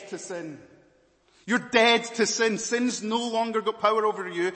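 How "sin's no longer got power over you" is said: normal talking voice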